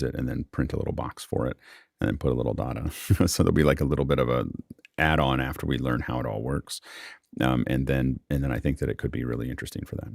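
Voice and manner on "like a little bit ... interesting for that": ordinary delivery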